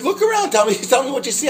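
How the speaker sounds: high-pitched retold phone reply; urgent instruction. Acted phone reply to lost caller.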